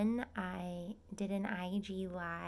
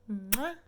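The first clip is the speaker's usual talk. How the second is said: loud smacking air kiss; kiss sound; 7 dB louder than usual. Real, not acted. Blowing viewers a kiss, loud smack.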